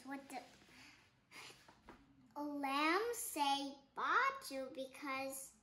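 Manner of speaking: ordinary delivery, nothing marked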